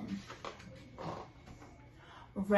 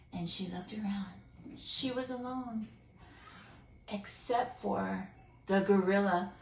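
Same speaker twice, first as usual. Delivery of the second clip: hushed suspense; 6 semitones lower, 6 dB quieter than usual. A narrator's reading. Suspense, alone with gorilla.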